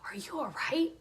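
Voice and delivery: whispered, gentle concern; soft whisper. Retold or imitated. Asking a grieving woman if she's okay, whispered. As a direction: breathe it softly to a hurting stranger.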